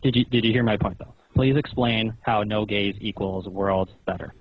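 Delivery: ordinary talk, nothing marked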